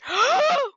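sharp loud gasp of fright; real jump-scare gasp; startled gasp. Real, not acted. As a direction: gasp as something jumps out. Startled by a mob in game.

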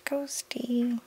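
quiet, high, drawn-out coo; soft spooky reveal. A narrator's reading. Reader revealing a painted ghost, softly playful. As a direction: sing it out softly.

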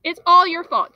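loud, high accusing outburst; boy shouting blame at the storekeeper; angry declaration. Acted. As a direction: hurl the blame at him. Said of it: "John declared".

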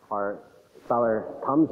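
normal talking voice

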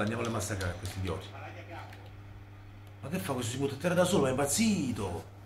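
ordinary talk

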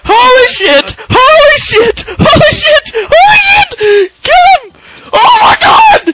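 high, loud, drawn-out screams; panicked screaming. Real, not acted. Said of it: "Are you just being overdramatic?".

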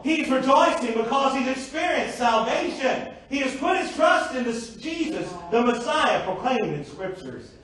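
emphatic, high-pitched preaching; preaching at full force. Real, not acted. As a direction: proclaim it fervently to the congregation.

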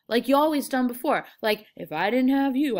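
exasperated, emphatic rant; giving him hell. Acted. George acting out giving Lenny hell.

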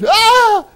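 loud, very high startled shriek; mock startled scream. Preacher acting out someone startled by the Spirit, mock scream. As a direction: scream as if caught by surprise.

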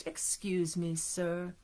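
soft, low, sugary politeness; butter him up gently and quietly; sweetest voice. A narrator's reading. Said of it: "said in his sweetest voice".

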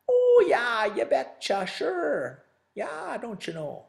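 hammed-up Fargo accent. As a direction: play up a cheery Minnesota Fargo accent. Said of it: "That's my Fargo accent".

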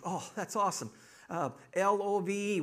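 acted relief at an easy test; delighted newcomer. Woman spells the word to Peter, delighted.

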